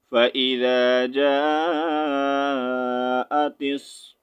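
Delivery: slow, drawn-out chanted recitation; Quran recitation. Shown as a demonstration. Teacher showing students how the verse is recited, slow chant. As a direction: recite it slowly like in prayer.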